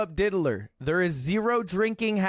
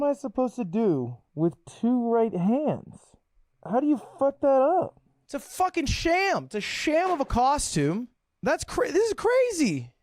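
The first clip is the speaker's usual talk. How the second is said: outraged; 7 semitones higher than usual. Real, not acted. Outraged at two right hands.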